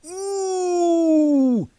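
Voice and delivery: long high sustained ooh; crowd "ooh". Retold or imitated. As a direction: sing out a wincing crowd ooh. Kids react on the sidelines.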